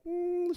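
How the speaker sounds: high, soft, pleased "mm"; happy hum. Retold or imitated. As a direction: hum high with quiet delight. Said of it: "she was all happy".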